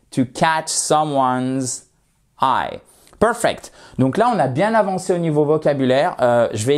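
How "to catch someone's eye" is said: slow model phrase. Shown as a demonstration. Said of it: "slow model phrase".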